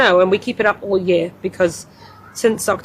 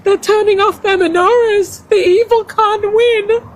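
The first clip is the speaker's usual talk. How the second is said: higher, louder, tearful pleading as a daughter; retold sobbing plea; 10 semitones higher, 3 dB louder, slower than usual. Mother retelling her sobbing daughter, a higher tearful plea. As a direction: voice the sobbing girl begging her mum, rising higher. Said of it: "she just sobbed and she said".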